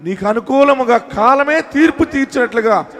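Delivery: high, loud prophetic proclamation; full-force preaching. Real, not acted. Preacher promising the troubled that justice is coming, full-force proclamation. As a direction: proclaim it at the top of your preaching voice.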